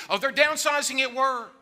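high, loud worried wail; exaggerated panic at bad news; mock alarm. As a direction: cry out in hammed-up dread. Acts out alarm at job news.

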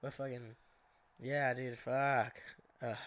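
congested, stuffy nose voice; talking through a blocked nose. Real, not acted. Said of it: "I'm fucking so congested right now".

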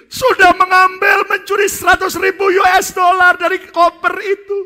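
high, loud sobbing cry; wailing confession. Acted. First pastor confessing to his friends, loud wailing sobs. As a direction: wail it out like a broken man. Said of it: "pendeta yang pertama mulai nangis".

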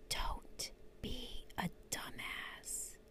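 breathy secret whisper, slow; cupped hand whisper. Acted. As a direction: lean in and whisper it. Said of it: "Let me whisper it in your ear".

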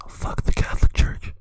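hushed, voiceless interjection; whispered aside. Real, not acted. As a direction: slip it in under your breath. Aside undercutting the inclusive disclaimer.

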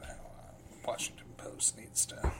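under-the-breath whisper. Real, not acted. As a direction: whisper it to yourself. Muttered aside.

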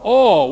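"all" shouted high and loud; stress on ALL. Shown as a demonstration. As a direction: punch "all" hard. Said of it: "say it with me".

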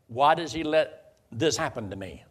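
ordinary delivery

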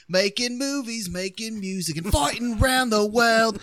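loud, high cartoon Australian accent; Russell Crowe. Quoting South Park's Aussie actor, loud hammed-up Australian boast. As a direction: belt it out like an Aussie brawler's theme. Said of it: "from South Park, Russell Crowe".

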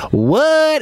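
high, drawn-out mock "What?"; exaggerated comic disbelief; imitating movie catchphrase. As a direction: hold the word long and high. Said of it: "he literally turns around and goes".

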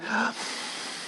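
long breathy tired sigh; weary sigh. Acted. Esau back from the field, worn out, weary sigh. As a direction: let out an exhausted sigh.

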